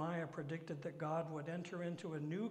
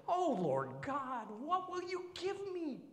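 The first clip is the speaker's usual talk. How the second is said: high, whining complaint; preacher mimicking a whiny Abram; 10 semitones higher, 5 dB louder, slower than usual. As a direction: whine like a needy child. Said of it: "Doesn't that sound kinda whiny?".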